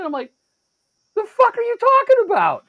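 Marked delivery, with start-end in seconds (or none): 1.1-2.6 very high, loud incredulous outburst; outraged disbelief. Retold or imitated. Shopper to the stranger behind him, outraged. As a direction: explode in baffled outrage. Retelling his outraged reply.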